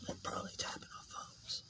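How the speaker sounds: whisper. Pretend. Fears mafia tapping phones.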